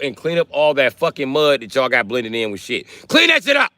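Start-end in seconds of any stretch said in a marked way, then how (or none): 3.1-3.7 loud, very high-pitched outburst; angry shout. Real, not acted. Streamer demanding a clean-up, high angry shout. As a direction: shout it high as an exasperated order.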